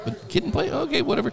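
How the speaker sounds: high, dismissive shrug in the voice. Retold or imitated. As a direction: brush it off with a scoff. Mock dismissive inner reaction.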